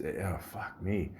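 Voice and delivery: quiet groan of throat pain; soft pained groan. Real, not acted. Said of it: "it hurts, man".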